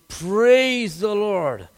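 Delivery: drawn-out, grateful Praise the Lord; a slow, wondering thank-you to God; slow awed praise. Retold or imitated. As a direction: let the thanks stretch out slowly. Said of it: "I jumped and praised the Lord".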